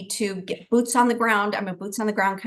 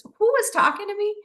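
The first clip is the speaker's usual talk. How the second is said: much higher, bewildered question; startled question; 12 semitones higher than usual. Retold or imitated. Hearing a stranger's voice in her ear, startled and bewildered. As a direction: spin round looking for who spoke.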